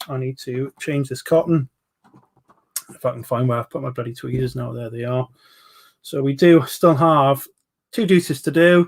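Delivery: normal talking voice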